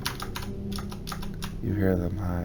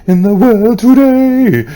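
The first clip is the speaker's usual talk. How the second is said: higher and louder proclamation; dramatic peak; 13 semitones higher, 9 dB louder than usual. Acted. Reading the cursed note, proclaiming loudly. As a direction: land the line like a doom verdict.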